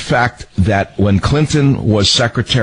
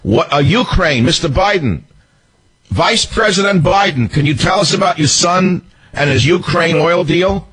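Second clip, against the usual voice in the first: mock reporter question; 9 semitones higher than usual. Host imitating press questioning Vice President Biden, mocking. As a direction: play a pushy reporter grilling him. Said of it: "Did they ever say to Joe Biden".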